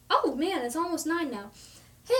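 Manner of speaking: usual speaking voice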